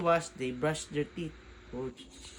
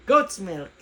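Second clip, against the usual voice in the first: laughing ad call; 4 semitones higher, 13 dB louder than usual. Acted. Ad line with laugh.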